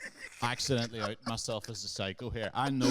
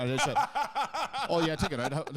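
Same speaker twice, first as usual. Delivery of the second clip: laughing, higher and louder; 9 semitones higher, 6 dB louder than usual. Real, not acted. Telling podcast host the taxi story, laughing. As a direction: laugh through the punchline. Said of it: "laughing about the joke".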